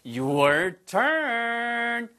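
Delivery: high, held call to the learners; drawn-out cue. Real, not acted. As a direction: sing out the cue, stretching the last word. Cueing learners to answer.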